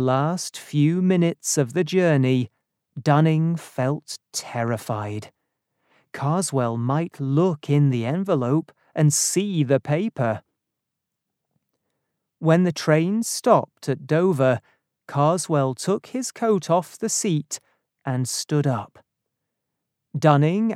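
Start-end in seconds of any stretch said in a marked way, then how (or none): none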